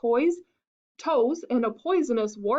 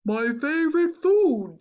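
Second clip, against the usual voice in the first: slow, loud, relishing monster; drawn-out monster voice with a lift in pitch; lightly voiced Gruffalo; 3 semitones higher, 5 dB louder than usual. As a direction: savor the words like a hungry beast.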